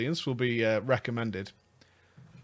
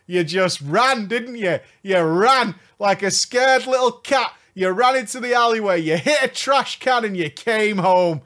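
high, louder teasing jeer; playful ribbing of a runaway; mock taunting; 7 semitones higher, 5 dB louder than usual. Real, not acted. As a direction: taunt him like a coward.

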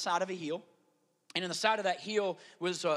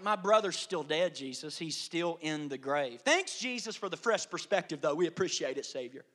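dry, dropping sarcastic thanks; sarcastic grief; 6 semitones lower, 4 dB quieter than usual. Acted. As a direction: thank him with bitter irony, fading soft.

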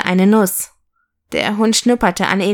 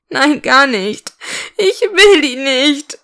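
put-on high, drawn-out childish whine; acted crying, slow and higher-pitched; tearful protest; 5 semitones higher, slower than usual. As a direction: wail it like a sulking little girl. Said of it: "weinte das Mädchen".

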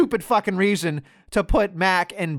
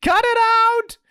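much higher, squeaky exasperated scold; mock scolding; 13 semitones higher than usual. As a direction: shriek at him to stop, hammed up. Mock scolding Kofi in quote.

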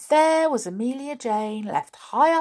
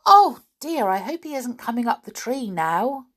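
higher, louder anxious cry; worried; 4 semitones higher, 6 dB louder than usual. A narrator's reading. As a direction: fret aloud, startled. Doll fears being caught.

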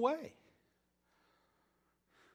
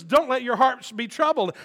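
preached; 10 semitones higher, 15 dB louder than usual. Shown as a demonstration. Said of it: "loud preached reading".

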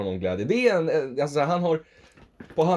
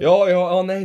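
loud, higher-pitched giving in; exasperated outburst; 8 semitones higher, 9 dB louder, slower than usual. Real, not acted. Podcaster gives in when challenged over listening habits, exasperated outburst. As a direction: cut in loudly, fed up.

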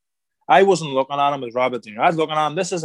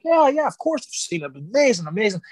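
high, eager "of course I've seen it"; pitched-up starstruck reassurance; gushing fake-casual; 8 semitones higher than usual. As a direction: hide the inner fireworks behind high casual praise. Playing it cool meeting De Niro.

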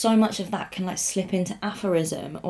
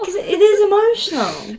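high, loud wail on the edge of tears; tearfully emotional; 13 semitones higher, 9 dB louder than usual. Real, not acted. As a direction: let the emotion push the voice way up. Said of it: "I'm gonna cry".

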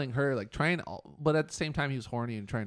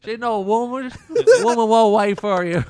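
laughing, much louder and higher hillbilly echo; 10 semitones higher, 17 dB louder than usual. Retold or imitated. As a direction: copy the hillbilly line while cracking up. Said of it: "like a hillbilly".

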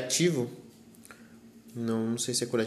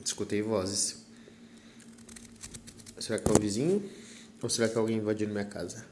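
hushed; 3 semitones lower, 7 dB quieter, faster than usual. Real, not acted. Hears voices in the house.

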